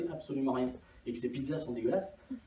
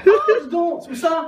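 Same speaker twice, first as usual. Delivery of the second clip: much higher and louder, hammed-up; mock excitement; 13 semitones higher, 13 dB louder than usual. Two colleagues joking about an old lady, hammed-up excitement. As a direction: overplay the surprise.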